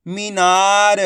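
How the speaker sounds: मीनार sounded out with long vowels; drawn-out long ई. Shown as a demonstration. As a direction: stretch मी and ना in मीनार. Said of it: "खींच के पढ़ा जाता है".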